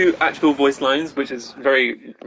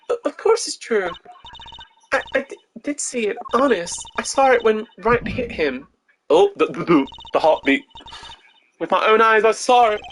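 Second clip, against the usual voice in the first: much higher, stammering insistence; high shaky witness voice; nervous witness; 8 semitones higher than usual. Acted. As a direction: insist too hard, voice up high. Witness Orley testifying nervously.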